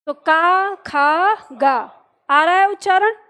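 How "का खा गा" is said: का, खा, गा each loud with a long open "aa"; consonants with long aa. Shown as a demonstration. Teacher has pupils use aa, का, खा, गा drilled loudly. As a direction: sound out का, खा, गा firmly, stretching the "aa". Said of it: "आ की मदद से करो".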